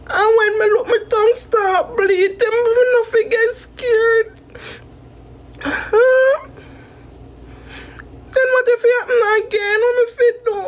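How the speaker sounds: high, tearful panicked crying; frightened sobbing plea, high and breaking; real panic. Real, not acted. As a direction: cry it out in real fear, voice pitched high. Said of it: "see how we be at this panic attack".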